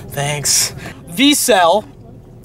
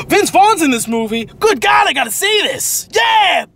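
excited; 8 semitones higher than usual. Real, not acted. Excited about a movie in haul.

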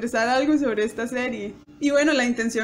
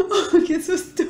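higher, laughing sigh of relief; 6 semitones higher, 3 dB louder, slower than usual. Retold or imitated. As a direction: laugh off the fright. Relief after scare.

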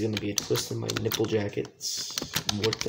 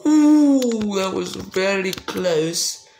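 high, loud "ooh", then slow stretched words; whoop, then drag out "very close"; startled relief; 5 semitones higher, 5 dB louder, much slower than usual. Real, not acted. Gamer after a near miss, slow startled relief.

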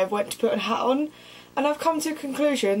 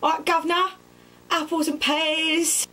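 higher, hammed-up Cockney accent; cheery Cockney geezer greeting; 6 semitones higher than usual. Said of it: "stereotypical Cockney pork pie hat".

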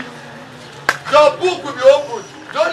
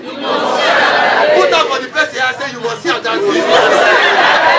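slow call to repeat; much slower than usual. Real, not acted. Crowd told to repeat.

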